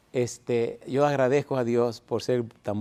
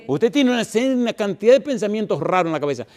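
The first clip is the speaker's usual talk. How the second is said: high, loud pulpit voice; full-force preaching; 8 semitones higher, 4 dB louder than usual. Acted. Preacher exhorting the congregation, high and loud. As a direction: drive it home to the congregation.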